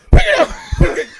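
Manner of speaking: high, loud barking order; mascot yelling. Retold or imitated. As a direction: yell it at the kid. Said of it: "and he's like".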